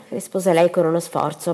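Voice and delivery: ordinary delivery, nothing marked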